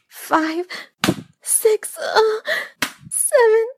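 high, loud yelps, building; crying out the count. Acted. Counting aloud during acted paddling.

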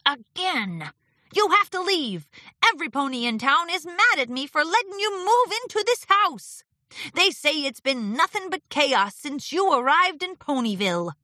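high-pitched, aggrieved pony voice; disgruntled Martingale, complaining. Acted. Martingale telling Discord to leave Ponyville, complaining.

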